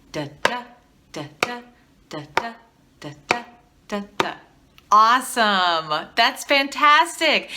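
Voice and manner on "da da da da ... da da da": "da, da" pairs, the second "da" stressed; iambic da-da beat. Shown as a demonstration. Teacher chanting the beat along with students, second da stressed. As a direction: lead the class through da-DA. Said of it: "here we go".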